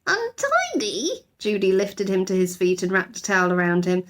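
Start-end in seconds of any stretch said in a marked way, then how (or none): 0.1-1.1 very high slow disbelieving question; high rising disbelief; incredulous echo. A narrator's reading.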